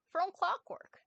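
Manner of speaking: high, loud, delighted reading of the note; excited exclaim. Acted. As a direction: burst out in bright surprise. Danny reads lunch tag, excited.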